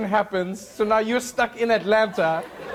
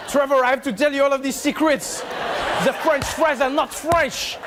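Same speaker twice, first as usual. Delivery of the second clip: higher, impassioned mock French accent; passionate French accent; 5 semitones higher than usual. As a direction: confess it dramatically in a French accent. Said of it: "you said that with passion".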